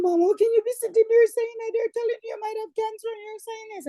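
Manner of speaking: high, distressed daughter's voice; upset daughter. Retold or imitated. Daughter upset at mother's calm.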